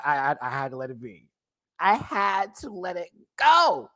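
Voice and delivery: usual speaking voice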